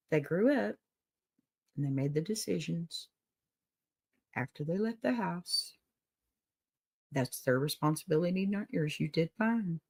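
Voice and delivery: soft comfort telling the letter writer they did fine; gentle reassurance. Real, not acted. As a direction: ease a parent's guilt gently.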